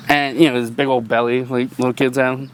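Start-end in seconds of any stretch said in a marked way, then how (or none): none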